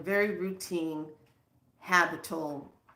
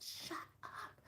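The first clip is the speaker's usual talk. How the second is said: stunned whispered shut up; 19 dB quieter than usual. Retold or imitated. Healer whispering amazement at client's news. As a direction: breathe it out in disbelief. Teller reacts in disbelief.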